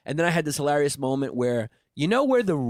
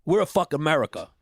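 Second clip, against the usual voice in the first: normal talking voice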